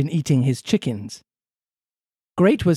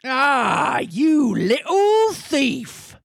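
high shouted Cornish farmer, slow; angry farmer; 10 semitones higher, much slower than usual. Acted. Farmer catching the boy thief, angry shout. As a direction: bellow at the thief. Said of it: "said the farmer in an angry voice".

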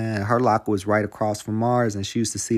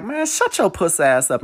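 higher-pitched mock telling-off; retold angry command; 7 semitones higher than usual. Friend tells the bully to shut up, angry. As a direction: act out shutting a bully up. Retells dude snapping at bully.

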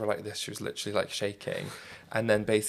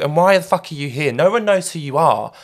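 louder, higher, aggressive yell; mock angry shouting; 8 semitones higher, 10 dB louder than usual. Kate Moss yelling at a stranger at a party, angry. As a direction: bark it like a furious celebrity. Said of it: "shouting all this abuse".

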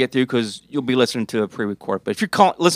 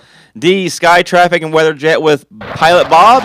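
radio announcer; 3 semitones higher, 7 dB louder than usual. Pretend. Radio-style traffic jingle intro.

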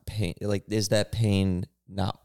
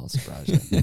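higher, teasing I'll surprise you; playful boast; 8 semitones higher than usual. Acted. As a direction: tease it with a lift. Teasing boast after a laugh.

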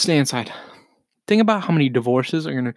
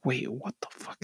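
normal talking voice

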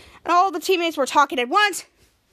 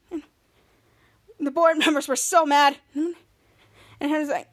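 ordinary talk

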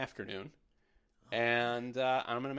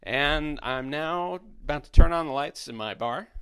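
flight attendant; 3 semitones higher, 10 dB louder than usual. Pretend. Guiding guests like a tour.

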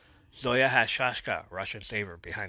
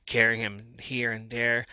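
ordinary talk

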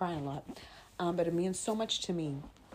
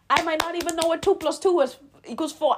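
emphatic fast rant; 10 semitones higher, 8 dB louder, faster than usual. Real, not acted. Rants she might not know math.

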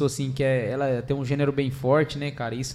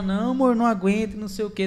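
pitched-up whiny mock protest; a hint of a pleading wife, raised higher; imitating wife; 6 semitones higher, 3 dB louder than usual. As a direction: do the tired wife begging to stop. Mimics wife's protest while running.

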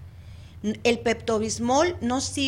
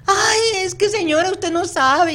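much higher and louder, hammed-up sobbing whine; imitating a weeping client; 8 semitones higher, 9 dB louder than usual. Therapist mimics a client crying to her, in a mock wailing voice. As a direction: wail it like the client breaking down. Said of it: "y empiezan".

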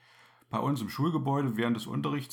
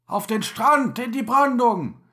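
loud, high command; shouted order; 9 semitones higher, 7 dB louder than usual. Acted. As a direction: bark the order over the flames. Captain ordering over fire.

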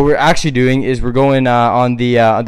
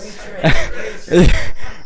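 laugh; 4 semitones higher than usual. Real, not acted. Laughs at his own slip.

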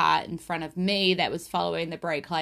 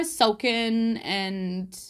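usual speaking voice

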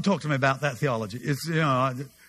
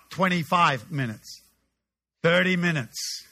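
ordinary delivery

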